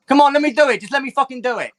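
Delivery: insistent Hermes begging the player; pleading eagerly. Acted. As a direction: beg to keep rubbing.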